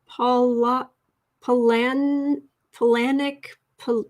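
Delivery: halting, questioning stabs at the name; tentative, broken-up tries with a questioning lift; unsure name attempts. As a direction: imitate customers fumbling a surname, trailing off. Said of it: "And they'd be like".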